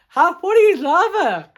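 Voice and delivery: high, loud, delighted aside; real amused exclamation; amused excitement. Real, not acted. Reader amused, aside to listeners.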